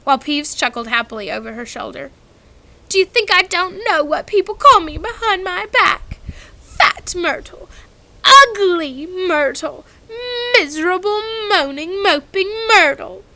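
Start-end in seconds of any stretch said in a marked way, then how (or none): 2.9-13.2 high, loud tearful wail; weeping Myrtle. A narrator's reading. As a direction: sob through the list of names. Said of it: "tears now flooding down her face".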